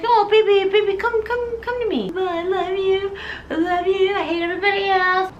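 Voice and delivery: high, cooing, slightly crying; hammed-up unhinged mother; creepy doting mom. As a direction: smother her with love. Mom comforts Carrie.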